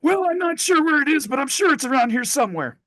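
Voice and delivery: punchline squeaked way up high; squeaky high voice. Acted. Doctor delivers the punchline in a squeaky high voice. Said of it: "the doctor looks at him and he goes".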